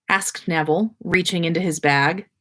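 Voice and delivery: ordinary delivery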